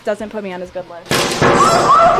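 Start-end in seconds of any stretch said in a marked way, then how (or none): 1.1-2.1 a loud, high yelp melting into laughter; startled laugh. Real, not acted. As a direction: laugh through the scare. Snake strikes, she laughs.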